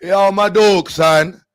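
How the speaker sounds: high, loud Jamaican patois; mock Jamaican accent. Uninsured driver arguing after a crash, loud mock Jamaican accent. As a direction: stretch it out in a hammed-up Jamaican accent. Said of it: "Then the Jamaican ones".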